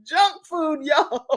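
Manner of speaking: high, loud, drawn-out scolding with a laugh; mock scolding. Host reading out Wanda's junk food comment, mock scolding. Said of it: "Wanda who is saying".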